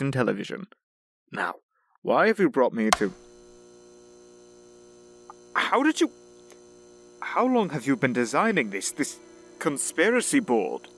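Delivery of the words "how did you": high-pitched, broken-off astonishment; startled. Acted. Butler discovers the conspiracy board, startled. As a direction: jump up high and stop short.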